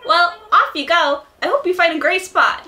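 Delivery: high, loud, chirpy helper voice; little shop helper. Acted. Handing Clint a tent, high chirpy helper voice. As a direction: pipe it up high and bright. Plays Timmy or Tommy.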